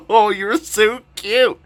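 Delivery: put-on slow, high-pitched baby talk; cooing. Vlogger to a cute Wii remote, playful high cooing. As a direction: gush over it like a tiny pet.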